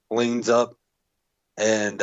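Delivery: ordinary talk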